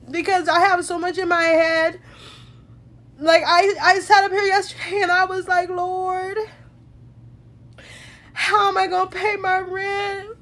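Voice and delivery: really choked up, talking through tears; crying gratefully. Real, not acted. Woman recalls praying over her rent, crying in gratitude. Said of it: "I'm crying because God is good".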